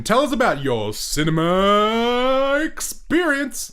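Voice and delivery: 1 mock host intro. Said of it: "host prompt after laughing".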